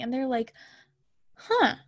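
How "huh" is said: high-pitched, mock-puzzled "huh". Circus ladies hearing her parents are missing, mock puzzlement. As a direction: play a mildly baffled reaction. Acting the women's offhand reaction.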